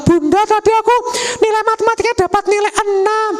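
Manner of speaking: put-on high child's voice, hopeful; little child. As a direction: report the grade like a small kid. Child reporting grade, voiced. (suara anak kecil melapor nilai)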